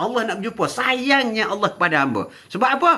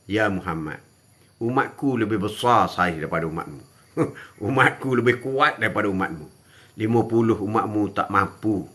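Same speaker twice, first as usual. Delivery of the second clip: low, softer voice with a chuckle breaking through; Musa's warning; 12 semitones lower, 3 dB quieter than usual. Acted. Musa telling Muhammad his ummah cannot manage fifty, low and knowing. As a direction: lower the voice and warn knowingly.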